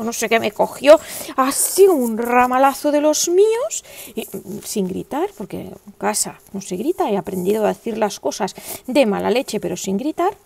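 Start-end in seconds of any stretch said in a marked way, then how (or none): none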